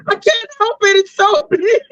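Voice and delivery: very high, near-tearful mock plea; imitating whiny excuse. Man's excuse mockingly imitated.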